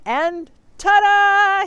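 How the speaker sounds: loud, high, very slow showman flourish; grand ta-da. Acted. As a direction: unveil it like a magic trick. Moose reveals turkey.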